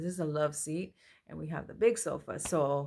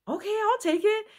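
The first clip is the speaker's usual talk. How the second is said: eager agreement; 7 dB louder than usual. Retold or imitated. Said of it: "And I said".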